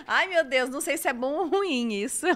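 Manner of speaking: loud, quick words through laughter; laughing. Real, not acted. Teacher unsure if comment is good or bad, laughing. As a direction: talk while cracking up.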